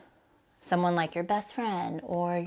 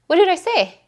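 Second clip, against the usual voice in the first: much higher and louder startled cry; shocked outburst; 12 semitones higher, 11 dB louder than usual. Retold or imitated. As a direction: blurt it out in sudden disbelief. Shocked question to husband.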